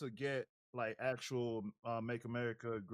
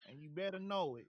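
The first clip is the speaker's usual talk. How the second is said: put-on higher, taunting Southern accent; hammed-up Southern taunt; 5 semitones higher than usual. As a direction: threaten like a Southern bully. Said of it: "Bubba's Southern maga country accent".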